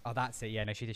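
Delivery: ordinary talk, nothing marked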